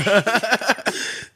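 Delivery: real burst of high laughter; laughing. Real, not acted. Laughing about getting beat up, real laughter. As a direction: laugh out loud at the memory.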